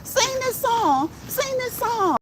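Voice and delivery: high, slow nagging singsong voice; mocking commenters. YouTube host mocking commenters who demand songs, in a high, slow singsong. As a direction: mimic pushy fans begging for a song. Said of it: "you'll hear it in the comments every day".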